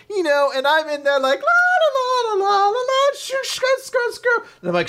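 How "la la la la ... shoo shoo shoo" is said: high, squeaky sing-song nonsense syllables; imitating mom's babble. Imitating how mom babbles at her daughter, high sing-song. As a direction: mimic mom chattering wordlessly at you. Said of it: "entirely how my mom communicates".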